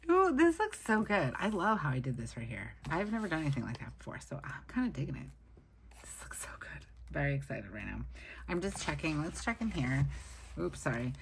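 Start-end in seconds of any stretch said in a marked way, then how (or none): 0.1-1.3 high, bright gasp of delight; thrilled ooh. Real, not acted. Admiring her finished spread. 6.0-6.9 voiceless, hushed praise; whispered. Real, not acted. Admiring quietly to herself.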